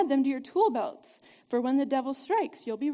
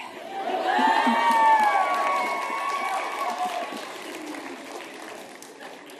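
big shouted laugh. Real, not acted. Laughs after the movie quote.

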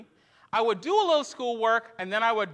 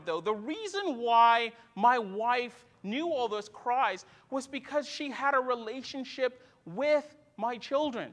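ordinary talk, nothing marked

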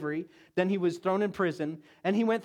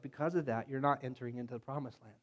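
lower, much softer fatherly reproach; quiet and measured, like gently calling him out; God talking privately with Moses, low and soft; calm voice of God; 5 semitones lower, 8 dB quieter than usual. Acted. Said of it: "And God was like".

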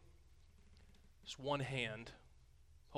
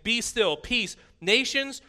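loud, much higher victorious command; the Lord silencing the nations, loud and triumphant; commanding shout; 7 semitones higher, 11 dB louder, slower than usual. Acted. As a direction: declare the nations beaten. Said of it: "the Lord coming, saying".

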